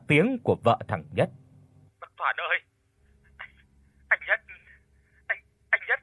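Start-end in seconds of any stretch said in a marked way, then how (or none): 2.0-6.0 softer, higher and higher broken sobbing; stammering through tears on the phone; daughter-in-law crying, rising in pitch. Acted. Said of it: "khóc lóc cái gì chứ".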